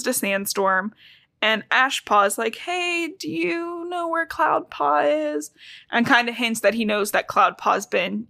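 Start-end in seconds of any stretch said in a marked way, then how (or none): 2.6-5.5 high, soft, drawn-out question; timid asking. Retold or imitated. Ashpaw asks where Cloudpaw is, high and soft. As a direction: voice a small, tentative inquirer.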